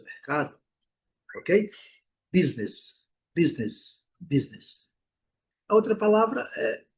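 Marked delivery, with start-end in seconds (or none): 2.3-4.9 "business" as "BIZ-nis", two syllables; English pronunciation. Shown as a demonstration. Teacher modelling the correct sound, English "business". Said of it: "Nada de business".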